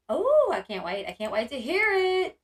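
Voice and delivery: gasp, then rise high and loud at the end; real eagerness, building to a high peak; excited anticipation. Real, not acted. Streamer replying eagerly to a viewer, excited anticipation.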